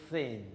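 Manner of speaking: "Thin" with a breathy, unvoiced TH; voiceless TH. Shown as a demonstration. Teacher models the unvoiced th in "Thin" for the class. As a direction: start "Thin" with a hissed, unvoiced th. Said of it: "No voice, okay".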